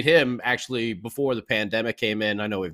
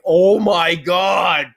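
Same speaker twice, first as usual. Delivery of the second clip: high, drawn-out exasperated yell; mock road rage, stretching the words; exasperated driver's rant; 5 semitones higher, slower than usual. Driver ranting at car waiting to turn, exasperated yell.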